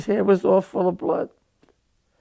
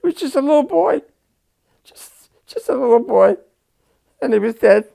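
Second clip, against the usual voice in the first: high, louder, on the edge of sobbing; voice breaking; 6 semitones higher, 5 dB louder than usual. Real, not acted. Veteran remembering the dying boy, voice cracking. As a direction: grief breaking through the words.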